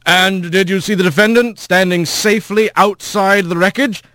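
leading the witness; lawyer voice. A narrator's reading. Lawyer questions.

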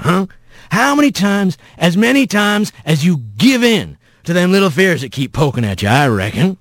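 low start, rising into pressing questions; folksy rough voice. Acted. Mysterious voice confronts Rat about his fears, folksy and pressing. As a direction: press him like a backwoods elder.

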